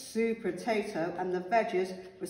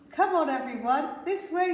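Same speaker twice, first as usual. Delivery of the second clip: higher, lively rallying call; 7 semitones higher, 5 dB louder than usual. A narrator's reading. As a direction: call the group to follow you. Carrot calls the group onward.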